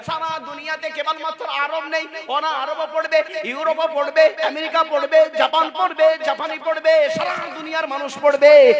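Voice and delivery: building from steady to a loud, high preaching cry; rising preaching. Real, not acted. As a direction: swell the list to a shout.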